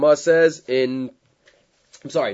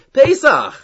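PESACH punched high and loud; emphatic correction; 12 semitones higher, 9 dB louder than usual. Retold or imitated. Correcting the Chazan with the Siddur, emphatic and high. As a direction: call out "Pesach" as the decisive missing word.

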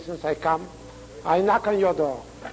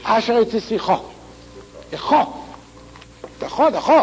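final אך hit hard and raised; single out the ending אך, repeating it sharply; stress on אך; 4 semitones higher, much faster than usual. Shown as a demonstration. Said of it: "upon which word will you place emphasis".

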